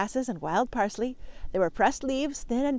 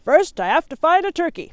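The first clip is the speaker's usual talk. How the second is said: loud, higher bellow back; 7 semitones higher, 9 dB louder than usual. Acted. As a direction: call back across the hillside. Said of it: "Mister Moose bellowed back".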